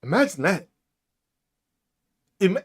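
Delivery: ordinary delivery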